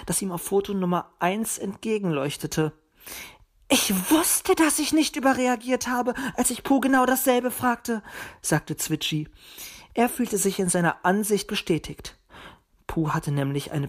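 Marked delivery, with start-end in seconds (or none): 3.7-8.0 high, indignant little bird. A narrator's reading. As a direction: speak up high, feeling vindicated. Small bird feels vindicated. (hohe, rechthaberische Vogelstimme)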